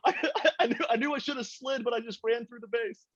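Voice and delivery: put-on scared little kid. Son apologizing to his yelling father, high scared kid voice. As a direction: squeak it out like a kid who got yelled at. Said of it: "my actual father ... I'm like".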